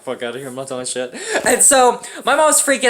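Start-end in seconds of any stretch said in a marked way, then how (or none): 0.0-1.1 muttered inner defiance. Retold or imitated.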